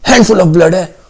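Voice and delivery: repeating bystander. Acted. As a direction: keep harping on "handful of blood" careless and loud. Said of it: "ये बात तीन चार बार repeat कर देता है". (बेपरवाह आदमी की तरह बार-बार दोहराना)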